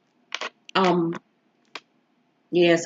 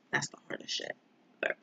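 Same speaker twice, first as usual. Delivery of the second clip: whispered repeat; 3 semitones lower, 16 dB quieter, much faster than usual. Real, not acted. Repeat, unvoiced.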